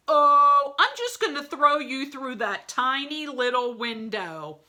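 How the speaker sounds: a hint of a cocky wolf; breezy wolf. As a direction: toss it off lightly. Wolf announces his plan.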